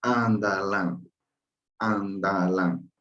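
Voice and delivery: "Andalán" said slowly, every syllable clear; slow model pronunciation. Shown as a demonstration. Teacher modelling a word for the class, slow and careful. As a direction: spell "Andalán" out slowly for learners. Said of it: "¿Cómo se pronuncia?".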